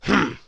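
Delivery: low disgruntled hmm; grumpy grunt. Acted. Mister Grumpy grunting at Mister Happy's advice. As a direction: grunt it sulkily. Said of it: "grunted Mister Grumpy".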